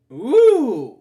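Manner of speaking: loud high startled ooh; a sudden loud gasping ooh; big loud surprised exclamation. Real, not acted. Reacts to door opening.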